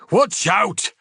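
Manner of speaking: high, loud sudden warning; snapped warning. Acted. Ravel stops the Vox operator stepping forward, snapping. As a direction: snap it to stop him. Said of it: "snapped Ravel".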